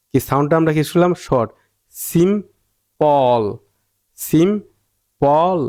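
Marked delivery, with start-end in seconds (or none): 1.9-3.4 "simple" drawn out as two slow parts, সিম, then পল; split into syllables. Shown as a demonstration. Teacher showing the separate sounds in simple, slowly syllable by syllable. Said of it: "এই যে সিম একটা, একটা sound আসছে". 4.2-5.6 "simple" stretched slowly, সিম and পল held apart; split into syllables. Shown as a demonstration. Teacher repeating simple for learners, slowly syllable by syllable. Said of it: "এই যে সিম একটা, একটা sound আসছে".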